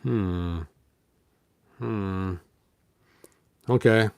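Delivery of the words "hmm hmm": plain reading voice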